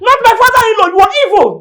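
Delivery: high, crying repeated accusation; tearful accusation. Acted. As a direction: hurl the word fraudster through tears. Daughter accuses father repeatedly.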